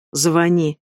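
ordinary delivery

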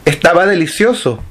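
high, sweet innocent voice; innocent lie. Acted. Pipina lies to the monster.

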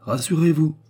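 répliquer vertement, voix plus aiguë; réplique verte. A narrator's reading. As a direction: riposte sèche et montée. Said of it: "répliqua-t-il vertement". (réplique verte)

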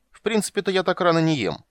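nose in the air. Acted. Dandelion turning up his nose at the halfling's soup. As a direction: sniff at the offer like a snob. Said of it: "задрал нос Лютик".

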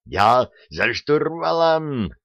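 high, drawn-out call; exultant yell. A narrator's reading. Petrovsky at the controls, high drawn-out yell. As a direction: stretch it out like a battle cry. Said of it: "проорал Петровский".

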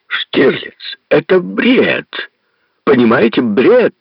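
high furious outburst; срывается на крик. A narrator's reading. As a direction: boil over into an angry yell. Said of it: "Мюллер сорвался на крик". (Мюллер кричит Штирлицу, что это бред, срываясь на крик)

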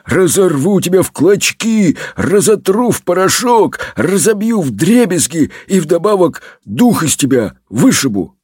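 ordinary delivery, nothing marked

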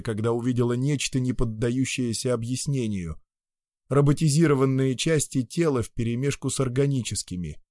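ordinary delivery, nothing marked

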